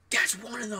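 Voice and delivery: high, excited imitation of a friend; excited exclamation. Friend points out the hippie to coworker, excited retold cry.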